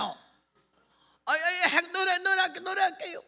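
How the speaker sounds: high, loud hurried promise; flustered appeasing. Retold or imitated. Preacher placating the old man with a song, flustered high reply. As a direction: give in with a high, flustered stammer.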